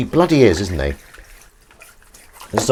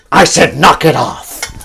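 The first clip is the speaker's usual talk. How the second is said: slow, louder gruff command; Harrison Ford impression; 6 semitones higher, 7 dB louder, slower than usual. Showing the Harrison Ford impression Emily mentioned, slow firm order. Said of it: "you do a good impression of Harrison Ford".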